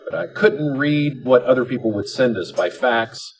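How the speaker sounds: ordinary talk, nothing marked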